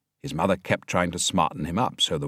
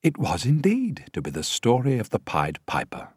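ordinary delivery, nothing marked

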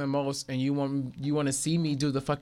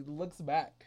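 ordinary talk

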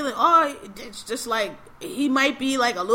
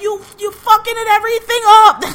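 high, loud exasperated outburst; frustrated mom; 9 semitones higher, 10 dB louder than usual. Retold or imitated. Mom blaming son for ruining everything, frustrated. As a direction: imitate a mother blowing up at her son. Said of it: "she finally got frustrated".

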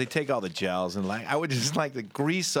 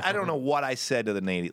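ordinary delivery

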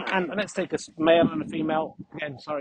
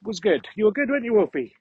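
doggy praise voice; 6 semitones higher, 5 dB louder than usual. Real, not acted. Praising own dog after face-off.

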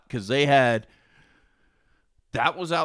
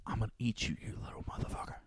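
soft unvoiced threat; creepy whisper; 4 semitones lower, 15 dB quieter, faster than usual. Friend whispering threats to the fish, creepy whisper. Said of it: "he's like".

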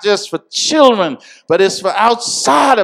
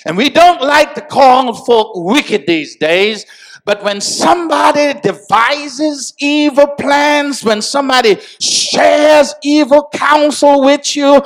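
louder and higher as the sermon builds; climbing into full-force preaching; rising preacher's shout; 8 semitones higher, 4 dB louder than usual. Acted.

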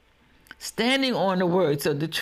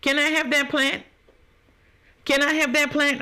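higher-pitched mock request; imitated asker; 6 semitones higher than usual. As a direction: play someone cluelessly asking. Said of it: "And then you look at me and say".

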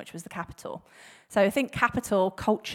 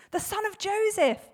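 momentous reveal; 11 semitones higher than usual. Acted. Philip naming Jesus to his friend, high-pitched reveal. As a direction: raise the pitch to finish the big news.

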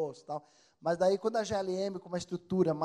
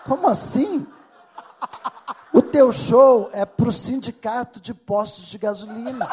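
laughing wife; 5 semitones higher, 14 dB louder, slower than usual. Retold or imitated. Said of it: "Ela falou".

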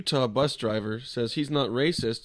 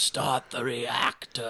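Quato voice; much slower than usual. Acted. Total Recall Quato impression.

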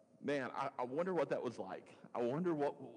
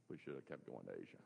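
grumbling regret; 12 semitones lower, 11 dB quieter, faster than usual. Retold or imitated. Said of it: "I'd be saying".

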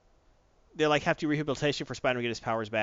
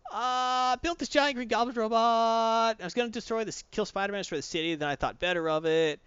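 sheepish Normie; 8 semitones higher than usual. Retold or imitated. Normie confessing to the heroes, sheepish and higher. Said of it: "He's getting up like".

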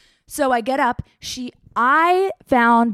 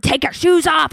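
higher, louder barked order; retold yell; 6 semitones higher, 5 dB louder than usual. Security guard yells at the traveler to remove shoes, loud. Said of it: "They're, like, yelling at me".

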